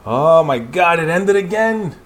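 real frustrated excitement; excited outburst. Real, not acted. Chapter ends suddenly.

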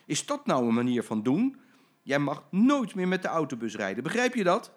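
a boss raising his voice at a late driver; scolding boss. Acted. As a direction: tell the driver off.

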